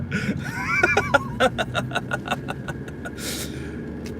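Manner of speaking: long, high, airy laughing fit; laughter. Real, not acted. Laughing at inheriting dad's temper, helpless giggle. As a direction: laugh on in high pulses, softly.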